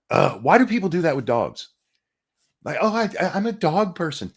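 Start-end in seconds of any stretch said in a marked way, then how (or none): 2.8-4.3 high-pitched mock gushing; put-on higher voice for a clueless person; mocking dog lover. Streamer imitating self-declared dog people, mocking.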